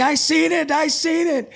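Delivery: very high, loud shrieking; piercing high-pitched excited yell; excited outcry. Retold or imitated. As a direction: imitate wife yelling in amazement. Wife's excited outcry, acted out.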